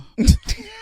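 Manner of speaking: loud, high-pitched laugh; burst of laughter. Real, not acted. Laughing at her own confession.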